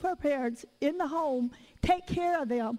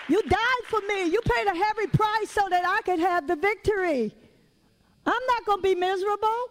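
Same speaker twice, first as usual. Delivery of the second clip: fervent declaration; 4 semitones higher than usual. Acted. Preacher models for the congregation how to declare to Jesus, fervent. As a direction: proclaim it up high to Jesus.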